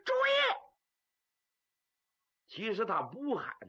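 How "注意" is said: sudden high-pitched yell; one sharp cry of alarm; shouted warning. Acted. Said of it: "这小子喊了一嗓子".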